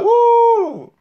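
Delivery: high loud whoop of delight; excited whoop. Real, not acted. Streamer sees his school friend online, high excited whoop.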